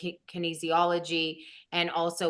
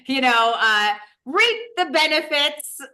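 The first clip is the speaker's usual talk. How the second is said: laughing through it, higher and louder; 8 semitones higher, 7 dB louder than usual. Real, not acted. Host laughing to guest about men's benefits, laughing. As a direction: giggle out the words as you go.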